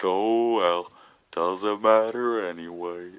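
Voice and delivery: slow, drawn-out glum drone; Eeyore. Retold or imitated. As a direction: give up with a mournful, dragged-out sigh of a line. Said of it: "all Eeyored it out of there".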